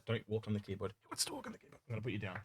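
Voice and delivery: scolding cat softly; aside to cat. Real, not acted. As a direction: mutter to the cat walking on the keyboard.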